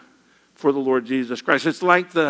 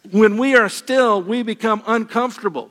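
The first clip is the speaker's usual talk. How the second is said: emphatic preaching; 5 semitones higher, 3 dB louder than usual. Real, not acted. Preacher builds a refrain.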